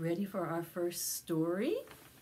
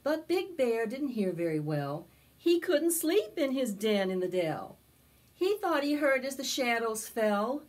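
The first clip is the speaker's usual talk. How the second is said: storybook narration; 5 semitones higher, 7 dB louder than usual. A narrator's reading. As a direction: tell it to a circle of little kids. Storytelling continues.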